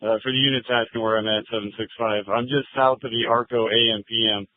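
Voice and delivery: officer speaking over the radio; thin, filtered radio voice; police radio. Real, not acted. Officer gives location by radio.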